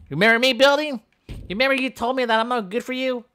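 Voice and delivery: high, accusing needling; playfully aggrieved, high-pitched challenge; mock taunt. Taunts the building.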